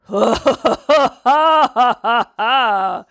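loud wolfhound guffaw; howling laugh. A narrator's reading. Old wolfhound mocks the little hare, howling with laughter. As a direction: burst into a long, hooting belly laugh. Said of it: "heulte der alte Wolfshund da auf".

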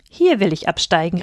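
ordinary delivery

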